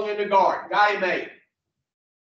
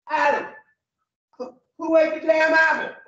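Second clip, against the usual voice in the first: shocked shout; 8 semitones higher than usual. Retold or imitated. God finding the apple gone, shocked shout. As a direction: yell it as if you just caught him. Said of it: "He was shocked".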